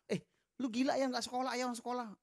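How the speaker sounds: mock a classmate nagging him to come to school; old schoolmate chiding the narrator for skipping class; scolding schoolmate.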